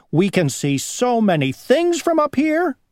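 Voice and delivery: high, airy boasting bug; squeaky show-off from the sky; dragonfly voice. Acted.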